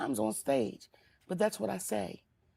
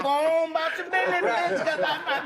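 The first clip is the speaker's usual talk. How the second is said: dismissive sass; 4 semitones higher, 4 dB louder than usual. Retold or imitated. Auntie dismissing a defiant girl, loud and sassy. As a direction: wave her off with attitude. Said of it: "I be like".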